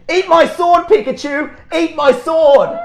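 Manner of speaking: high, loud mocking child voice; bratty kid taunt. Kid jabbing a balloon sword at Pikachu, loud bratty taunt. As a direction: jab the words like a cheeky little kid.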